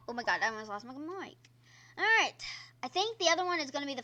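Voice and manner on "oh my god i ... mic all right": ordinary talk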